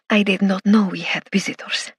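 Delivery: put-on lightly Spanish-accented English; a hint of a Spanish accent, apologetic. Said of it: "in a heavy Spanish accent".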